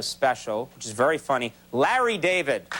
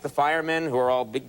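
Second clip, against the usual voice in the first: ordinary talk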